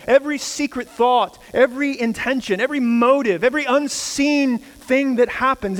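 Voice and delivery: rising preaching. Acted. Pastor to congregation on God's judgment, mounting preaching. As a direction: build the sermon toward a climax.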